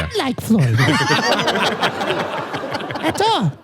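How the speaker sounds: put-on very high old granny voice; Greek grandmother. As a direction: squeak it out as a Greek yaya. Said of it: "this is Yaya".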